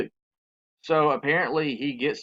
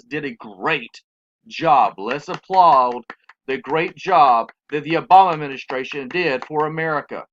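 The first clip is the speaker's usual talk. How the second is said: sarcastic praise; 8 dB louder than usual. Real, not acted. Sarcastic praise after listing failures.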